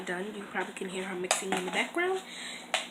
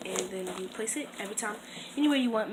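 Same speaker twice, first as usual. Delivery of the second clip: ordinary talk, nothing marked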